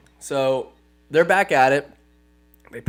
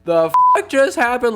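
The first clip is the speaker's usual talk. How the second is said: higher, louder outraged question; stunned disbelief; 8 semitones higher, 5 dB louder than usual. Acted. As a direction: blurt it in shock. Aragorn in disbelief.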